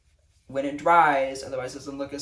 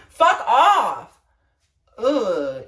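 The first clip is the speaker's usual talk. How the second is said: loud, high fuck off; shouted fuck off; 6 semitones higher, 10 dB louder, much slower than usual. Real, not acted. Dismissing promposers with a loud, high shout. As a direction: bark it at them.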